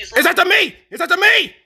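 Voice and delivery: high-pitched alarm while being chased; panicked yell. Real, not acted. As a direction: cry out high to warn teammates. Chased in the game.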